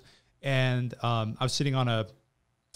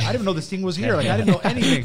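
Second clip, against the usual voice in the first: higher and louder, laughing in disbelief; amazed disbelief; 7 semitones higher, 6 dB louder, faster than usual. Retold or imitated. Young guest stunned by the private room, laughing in amazement. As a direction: blurt it out, half laughing at how fancy it is.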